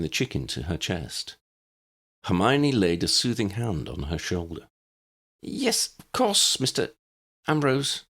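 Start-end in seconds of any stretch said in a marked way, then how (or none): 5.4-8.0 high, hesitant voice with pauses; faltering neighbour. A narrator's reading. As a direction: stumble over the words, high and unsure. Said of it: "Betty faltered".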